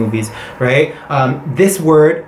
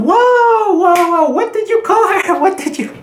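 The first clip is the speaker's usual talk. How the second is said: much higher, mock-shocked whoa. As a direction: react as if scandalized. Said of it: "I was like".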